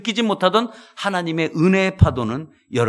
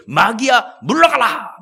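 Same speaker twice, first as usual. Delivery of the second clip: higher, louder command at the devil; rebuking shout; 5 semitones higher, 5 dB louder, slower than usual. Acted. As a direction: cast the devil out loudly. Man rebuking devil in prayer. (마귀를 꾸짖는 외침)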